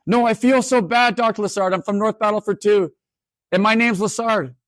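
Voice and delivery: retold student, voiced high; imitating a distressed student in class; upset student. Student keeps insisting.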